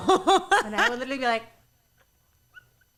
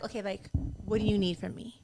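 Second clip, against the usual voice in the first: softer, lower, caring question; genuine, calm; 5 semitones lower, 5 dB quieter than usual. Retold or imitated. Boyfriend asking what she needs, genuine, soft and low. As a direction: imitate him sincerely offering help. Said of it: "it was genuine".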